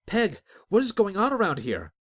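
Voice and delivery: high, loud frantic question; alarmed cry. Acted. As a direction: burst in shouting for answers. Azric bursts in alarmed.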